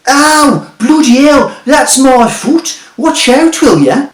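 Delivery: high, loud yell of pain and outrage; pained angry yell. Acted. Man in the gutter yells at Jeremy with a pained furious shout. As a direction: shout as your foot gets crushed.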